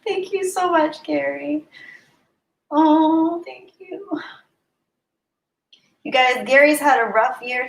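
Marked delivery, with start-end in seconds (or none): 0.1-4.1 high, choked-up thanks; moved to tears. Real, not acted. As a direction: squeeze the thanks out high, overcome by a friend's gift. Moved by gift and kind message.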